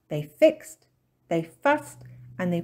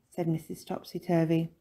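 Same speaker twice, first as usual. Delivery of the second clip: ordinary delivery, nothing marked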